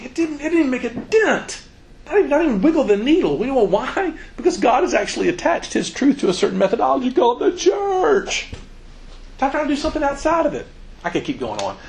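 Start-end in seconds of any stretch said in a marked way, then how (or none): 0.0-10.7 preaching at full force. Real, not acted.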